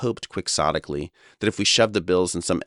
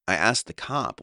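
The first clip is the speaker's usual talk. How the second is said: ordinary delivery, nothing marked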